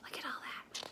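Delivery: softly whispered wonder; awed whisper. Acted. The bear whispering in awe at the furniture. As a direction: breathe it out in amazement. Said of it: "whispered in awe".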